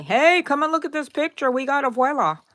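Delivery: high excited call; gleeful shout to the family to come look; calling family over. Retold or imitated. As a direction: call everyone over to see the photo. Family showing off the photo.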